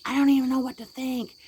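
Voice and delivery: loud, high-pitched angry outburst; mock furious, voice thrown up high; imitated anger. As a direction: act out a mother at the end of her patience. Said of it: "angry, and just threw him".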